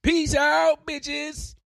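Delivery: high, loud farewell holler; big playful parting shout; shouted sign-off. Pretend. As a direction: yell goodbye at a high pitch.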